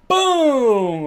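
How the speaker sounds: loud, high, drawn-out boom; big exclaimed sound effect; explosion boom. Acted. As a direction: burst out with an imitated explosion. Imitates an explosion.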